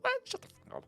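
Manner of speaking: high, sneering mock voice; exaggerated mocking whine. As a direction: sneer it like a whiny critic. Mocking imagined complainers.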